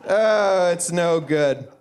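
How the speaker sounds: usual speaking voice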